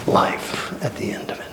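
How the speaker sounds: soft whisper to close the line. Acted. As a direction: drop to a whisper on the promise. Hushed phrase inside sermon.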